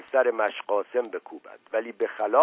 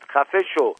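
shouting; 5 semitones higher, 5 dB louder than usual. A narrator's reading. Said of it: "فریاد زد".

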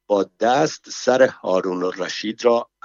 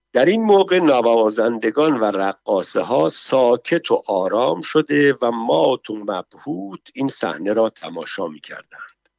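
usual speaking voice

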